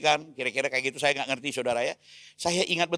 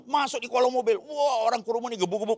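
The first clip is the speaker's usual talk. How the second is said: normal talking voice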